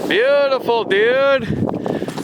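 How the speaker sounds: high, loud delighted cheer; thrilled praise. Real, not acted. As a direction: cheer him on, overjoyed. Repeats praise much higher.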